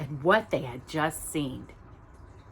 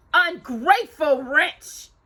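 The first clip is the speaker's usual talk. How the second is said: high, slow, loud shriek; shrieking stepsister; 9 semitones higher, 5 dB louder, slower than usual. Acted. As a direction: drag out each word in contempt. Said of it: "she shrieked".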